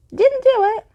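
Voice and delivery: very high, loud, strange cry; ghost girl's cry. Acted. Hidden ghost girl crying out from the cupboard. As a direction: shriek it from a hiding place. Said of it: "The cry came from".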